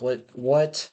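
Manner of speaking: ordinary talk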